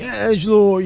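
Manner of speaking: loud, high, intense edgy call; intense delivery. Retold or imitated. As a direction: punch it out with mock intensity. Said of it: "We were so intense saying".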